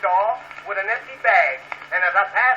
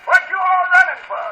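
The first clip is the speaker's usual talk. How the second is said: higher-pitched imitation of a sister hollering; mock holler, pitched up; 6 semitones higher than usual. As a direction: call out high, mimicking your sister. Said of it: "come out and hollered".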